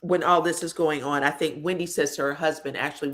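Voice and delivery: ordinary talk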